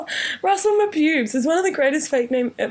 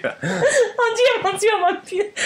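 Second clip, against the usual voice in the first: put-on French accent; 7 semitones higher, slower than usual. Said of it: "French isn't a bad go-to".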